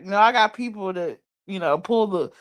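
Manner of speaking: ordinary talk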